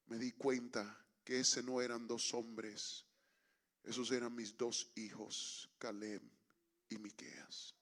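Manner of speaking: low, soft storytelling with long pauses; hushed sermon climax. Real, not acted. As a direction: let the revelation land quietly.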